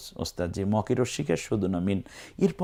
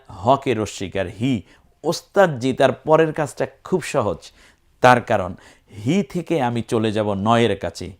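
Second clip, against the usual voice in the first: ordinary talk, nothing marked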